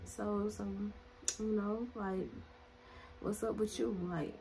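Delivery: soft hesitant come-on; quietly fishing for a chat; creepy man. Retold or imitated. Stranger pestering teen girl, soft hesitant come-on. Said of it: "And he's like".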